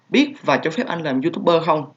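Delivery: usual speaking voice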